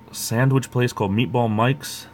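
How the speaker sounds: normal talking voice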